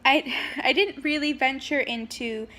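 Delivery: normal talking voice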